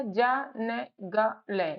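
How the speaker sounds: जा न ग ल spaced out slowly, each syllable separate. Shown as a demonstration. Teacher spelling out jungle slowly, syllable by syllable. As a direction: break the word into slow separate sounds. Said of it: "कैसे पता चलेगा".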